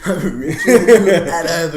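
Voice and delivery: loud, high words through laughter; laughing hard. Real, not acted. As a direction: crack up while mocking the boast. Cracking up repeating friend's boast.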